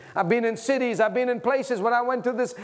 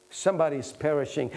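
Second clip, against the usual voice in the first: usual speaking voice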